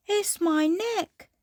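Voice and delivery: high, slow giraffe voice; high animal character voice. Acted. Giraffe speaks.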